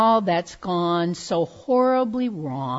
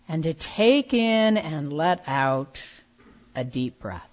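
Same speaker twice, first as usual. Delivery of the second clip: calm grounding exercise. Real, not acted. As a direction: lead them gently into stillness. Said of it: "let go of distractions".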